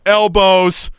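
high sideline call; re-enacted yell. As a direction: holler it out as a protest. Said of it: "by yelling".

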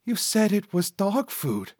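sidekick's high-pitched objection; high-voiced complaint. Cecil complains to Vernal.

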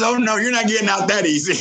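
high, laughing tease from a dying mom. Retold or imitated. Dying mom teasing her son through a high laugh. Mom's joking line retold, laughing.

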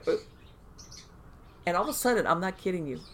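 ordinary delivery

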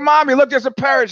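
put-on high, loud child's call; high-pitched little girl voice; little daughter. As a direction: pipe up like a child calling to mom. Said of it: "the daughter's like".

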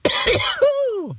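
clearing a rough morning throat with a cough; a real cough after sipping coffee. Real, not acted. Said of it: "and then cough afterwards".

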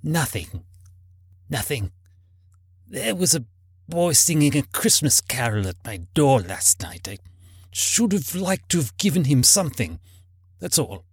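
put-on subdued, regretful old man. A narrator's reading. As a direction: trail away with remorse. Scrooge regretful, answering the ghost.

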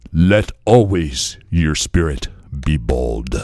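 put-on low, slow, solemn African accent; bad African accent. Drunk African man sharing philosophy, low solemn imitated accent. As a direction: pronounce it gravely in a mock African accent. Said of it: "I'm doing his accent terrible".

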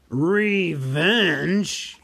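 loud scornful snort of a word; snorting dragon. Acted. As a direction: spit it out like a scoffing dragon. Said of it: "he snorted".

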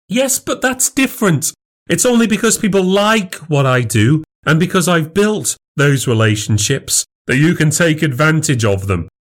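very high, insistent protest; flustered self-defence. Acted. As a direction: argue back, stung. Wife defends herself in quarrel.

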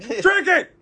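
high, loud barked order; aggressive. Retold or imitated. Drive-thru worker orders customer about, aggressive shout. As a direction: bark it like a mock drill sergeant. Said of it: "they're all aggressive".

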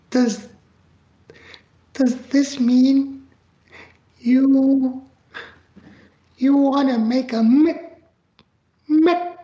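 high, slow, stammering with awe; nervous stammer. Retold or imitated. He stammers to the famous director on the phone, awed. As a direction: choke on the words, starstruck. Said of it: "and I say".

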